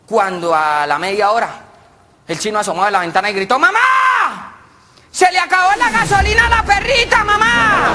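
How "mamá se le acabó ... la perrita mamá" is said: high, loud shout out the window; kid yelling. Retold or imitated. Boy yells from window to his mother, high and loud. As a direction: holler it to mom across the yard.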